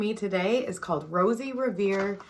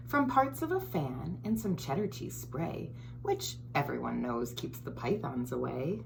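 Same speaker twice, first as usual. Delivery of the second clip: put-on lively storybook read-aloud for kids; reading to children; 4 dB quieter than usual. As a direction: read it like story time for little ones. Reads picture book to children.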